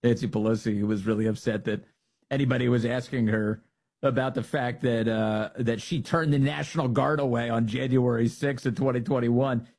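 usual speaking voice

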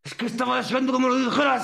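high, rushed protest; mock-indignant outburst. Waiting to be corrected on a name, mock indignant. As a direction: blurt it out fast and high-pitched.

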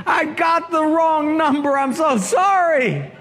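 high, embarrassed rush of sorry; flustered apology. Retold or imitated. Caller apologises for wrong number.

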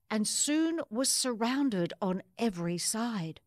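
put-on lively rhyming read-aloud for children; story narration. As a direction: bounce through the rhymes like a storybook. Reads picture book aloud.

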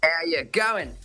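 put-on Aussie accent. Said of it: "fantastic Aussie accent".

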